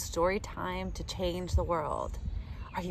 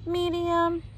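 medium said higher, playfully; 8 semitones higher than usual. Acted. Reading sizes to kids, playfully higher. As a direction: pitch it up for the middle size.

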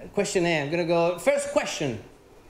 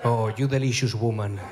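mock seductive lover; 11 semitones lower than usual. Wooing the chicken heart lady, a much lower mock seductive croon. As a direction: croon it low to the woman.

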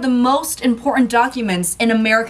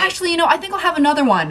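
eager and fast; 8 semitones higher, much faster than usual. Acted. Greedy customer ordering more.

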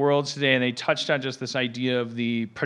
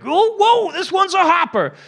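much higher, loud whooping cowboy pilot; Yosemite Sam; 8 dB louder than usual. Pretend. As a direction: holler like a gleeful cowboy captain. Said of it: "a Yosemite Sam guy going like".

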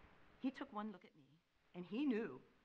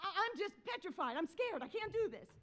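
high, stammered petrified confession; acted-out scared pleading; retold blurting; 8 semitones higher than usual. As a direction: blurt it out in mock fright. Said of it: "I'm blurting out".

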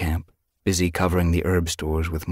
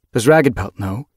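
businesslike question, louder and higher; brisk; 6 semitones higher, 7 dB louder than usual. A narrator's reading. Sage Whisker questioning Yellowfang about the father, brisk. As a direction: ask it briskly with raised voice. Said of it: "Her tone became brisk again".

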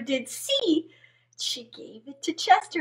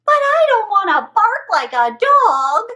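high, loud protest; complaining frog; 6 semitones higher, 6 dB louder than usual. Acted. Frog protests to the witch, high.

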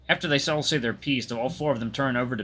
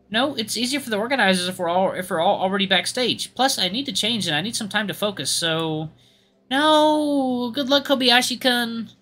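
explaining, then well-wishing; 10 semitones higher, 3 dB louder than usual. Acted. Voices characters parting before competition.

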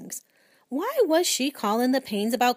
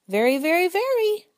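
high, eager little squeaks rising higher; excited squeak; 9 semitones higher, 6 dB louder than usual. Acted. Hamster eagerly agreeing with the principal, in high excited squeaks.

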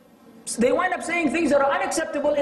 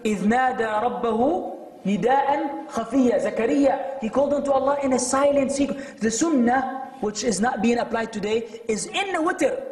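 normal talking voice

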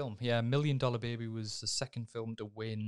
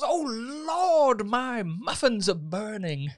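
loud, very high mock panic, slowing at the end; fussing biker; 10 semitones higher, 9 dB louder, much slower than usual. Hardened biker panicking over his muffins, high and loud. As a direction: camp alarm about the baking. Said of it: "he goes".

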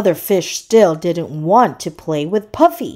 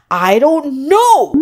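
loud, high, drawn-out storybook shout; frustrated shout; 7 semitones higher, 5 dB louder than usual. A narrator's reading. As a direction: shout it crossly, stretching the words slowly. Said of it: "Puffy shouted".

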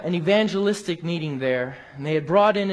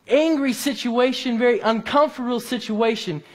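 higher-pitched, driving sermon delivery; emphatic preaching; 5 semitones higher than usual. Real, not acted. Preacher urging friends to follow the leader, impassioned preaching. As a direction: press the point home to the congregation.

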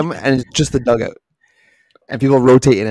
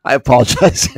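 normal talking voice

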